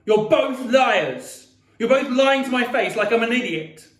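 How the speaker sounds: loud, high furious accusation; screaming in anger. A narrator's reading. Boy turning on mother and sister, screaming. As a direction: yell it at both of them. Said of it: "I screamed at Via, getting up".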